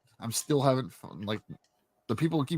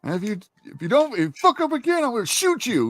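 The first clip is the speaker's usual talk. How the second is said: hammed-up sergeant threat; 10 semitones higher than usual. Marine sergeant threatening a repeat offender, hammed-up mock threat. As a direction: ham up a sergeant threatening a recruit.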